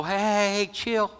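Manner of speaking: high, drawn-out brush-off; mock dismissive Jesus. Preacher voicing what Jesus didn't say to frantic sisters, mock dismissive. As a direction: put on a hammed-up, high-pitched calming call. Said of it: "Jesus didn't go".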